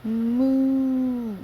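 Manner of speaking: long loud high "Moo"; cow moo. Acted. Cow voice in story.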